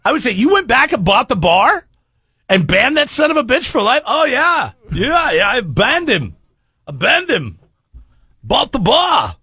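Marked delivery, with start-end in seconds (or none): 0.3-4.0 high, eager admiring voice; impressed listener. Pretend. Campfire listener gushing, high and eager. Imagined campfire chat with Conor. 4.0-9.3 strut it like a boastful Irish pub owner; mock Irish accent. Conor boasting at the campfire, hammed-up Irish accent. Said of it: "Irish accent".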